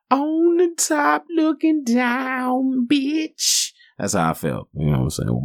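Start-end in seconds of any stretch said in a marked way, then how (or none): 0.1-3.7 much higher, slow, drawn-out falsetto crow; triumphant falsetto. Acted. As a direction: stretch it out high like a victory cry. Bragging about standing on top.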